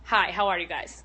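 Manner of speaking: ordinary talk, nothing marked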